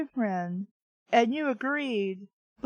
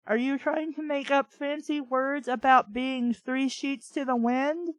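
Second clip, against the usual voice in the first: ordinary talk, nothing marked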